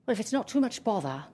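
put-on modest dog with a polite English accent. A narrator's reading. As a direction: accept diffidently in a genteel English accent. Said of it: "the dog spoke with an English accent".

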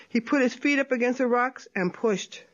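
usual speaking voice

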